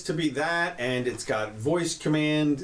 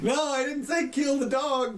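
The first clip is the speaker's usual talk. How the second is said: high, slow mock protest to a robot; mock panicked command; 12 semitones higher than usual. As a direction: pretend to correct a drone that misheard, with a high, slow voice. Joking about a misheard voice command.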